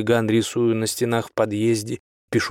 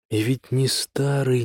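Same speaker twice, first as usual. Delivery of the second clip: usual speaking voice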